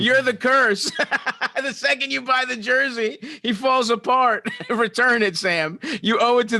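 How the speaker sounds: high, excited mock accusation, laughing at him; gleeful shout of blame, way up high; delighted teasing cry of "you're the curse". Real, not acted. Teasing friend about the jersey curse.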